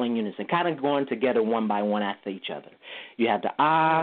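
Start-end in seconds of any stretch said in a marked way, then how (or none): 3.6-4.0 "ah" held level and high; first tone. Shown as a demonstration. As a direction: say "ah" on one straight, steady note. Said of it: "the first tone".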